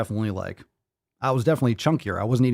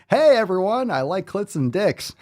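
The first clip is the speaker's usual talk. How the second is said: higher, louder proud declaration; mock proud announcement; 8 semitones higher, 4 dB louder than usual. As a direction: announce it like a sign. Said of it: "repeat of the sign joke".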